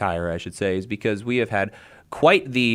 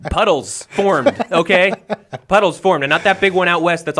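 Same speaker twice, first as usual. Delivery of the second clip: laughing protest; 7 semitones higher, 6 dB louder than usual. Acted. Mock-defending San Diego's rain, laughing.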